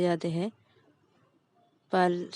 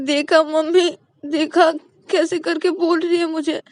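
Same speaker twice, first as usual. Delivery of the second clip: tattling; 9 semitones higher, 8 dB louder than usual. Acted. Payal tattles to mom.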